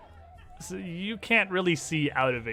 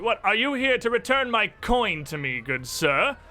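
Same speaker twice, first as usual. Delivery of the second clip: higher-pitched, theatrical indignation at an insult; Delamar confronting; 6 semitones higher, 3 dB louder than usual. Acted. Game master as a villain challenging an approaching player, indignant. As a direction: demand it like an affronted stage villain. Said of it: "and sees you".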